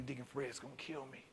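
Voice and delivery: barely above a breath, afraid of what's coming; scared thought. Retold or imitated. Scared boy's inner thought.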